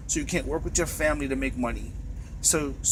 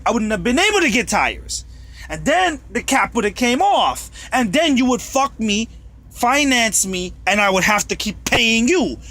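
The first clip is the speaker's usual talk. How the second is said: angry rant; 6 semitones higher, 4 dB louder than usual. Real, not acted. Ranting escalation at company.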